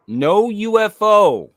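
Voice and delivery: slow, high and loud pronouncement; booming official read. Acted. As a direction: announce it like a grave official verdict. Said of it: "the memo flatly states".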